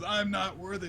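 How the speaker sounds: hammed-up unworthy little boy; mock humble squeal. Momonosuke shrinks from Luffy's handshake, mock humble squeal. Retelling Momonosuke's awed refusal.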